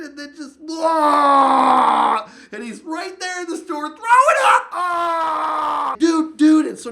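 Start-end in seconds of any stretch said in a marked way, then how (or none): 0.6-2.3 loud, high retching blurt; sudden imitated heave, shouted; mock vomiting noise. Retelling friend vomiting in store. 2.5-6.7 high, loud frantic yell; hammed-up alarm, voice cracking high; panicked shouting. Panicked retelling of friend vomiting.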